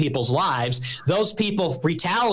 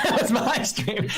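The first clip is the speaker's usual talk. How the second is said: laughing correction; 6 semitones higher than usual. Real, not acted. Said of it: "s-, my, it was my stream".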